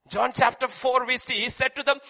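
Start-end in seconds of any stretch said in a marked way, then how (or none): none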